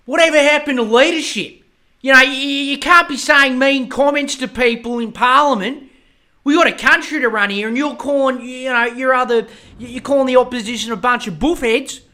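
high, loud, indignant pundit parody; mocking Sky News pundit. Mocking Sky News scolding Albo, loud whiny outrage. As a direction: whine like an outraged TV commentator. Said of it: "was like".

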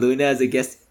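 ordinary talk

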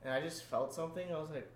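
ordinary talk

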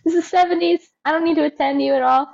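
high, breezy careless dog owner; mock cheery owner waving the dog off. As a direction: toss it off, high and carefree. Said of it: "You're like".

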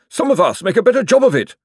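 high, loud, snappish retort; snapping. A narrator's reading. Josephine retorting to Cosmo, high loud snap. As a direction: snap back at him sharply. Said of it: "snapped Josephine".

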